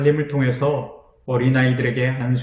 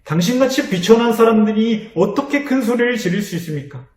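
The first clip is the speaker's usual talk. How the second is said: higher-pitched scornful rebuke; the crowd rebuking the blind man, a mild mock scold; 8 semitones higher than usual. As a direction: scold him as a nobody. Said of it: "하고 꾸짖어요".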